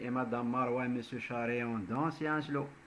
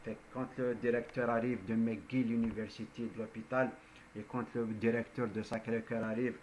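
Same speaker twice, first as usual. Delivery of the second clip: ordinary talk, nothing marked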